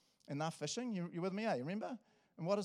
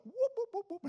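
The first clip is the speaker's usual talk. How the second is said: much higher, louder whoops as he flees; mock alarm whoop while sprinting off; playful high whooping as [name] runs away naked; whooping siren-like yelps; 6 dB louder, faster than usual. [name] fleeing naked, sound effect.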